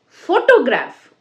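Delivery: photograph stressed on FO, "FO-to-graf"; first-syllable stress. Shown as a demonstration. Teacher demonstrating word stress, "photograph" as "FO-to-graf". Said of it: "what is syllable stress".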